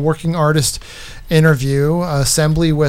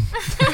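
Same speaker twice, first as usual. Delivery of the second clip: high-pitched laugh; 5 dB quieter than usual. Real, not acted. Amused at own plea.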